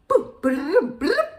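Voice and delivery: high, loud bouncy boops; playful batting noises. Retold or imitated. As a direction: imitate a kitten knocking things about. Mimics kitten batting AirPods around.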